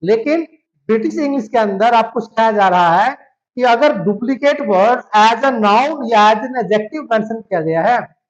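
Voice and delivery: ordinary talk, nothing marked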